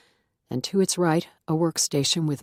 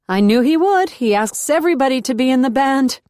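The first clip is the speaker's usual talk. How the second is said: higher, louder chirpy coworker voice; Martina; 9 semitones higher, 8 dB louder than usual. A narrator's reading. Martina to Beth in the basement, high and eager. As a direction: ask eagerly, already knowing.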